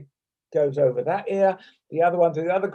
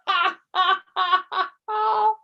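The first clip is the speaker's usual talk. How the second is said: much higher, laughing has; has turning into laughter; 13 semitones higher, slower than usual. Shown as a demonstration. Teacher's ha breaths break into laughter, high giggling has. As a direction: let the has tip into real giggles. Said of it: "keep doing that until you get to seven ha's".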